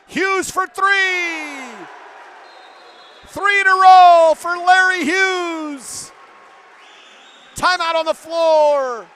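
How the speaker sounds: high, loud call with long drawn-out words; excited play-by-play shout. Real, not acted. Commentator calls a three-pointer to the radio audience, excited shout. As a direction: belt it out, stretching the big words.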